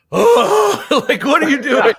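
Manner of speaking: very high, loud, laughing yell of disbelief; dad yelling at his son in the race, laughing shout; exasperated yell. Retold or imitated. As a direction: relive the dad's cry from the bleachers.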